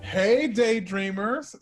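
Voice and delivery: normal talking voice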